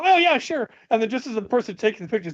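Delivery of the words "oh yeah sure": cheerful lieutenant. Retold or imitated. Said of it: "And he go".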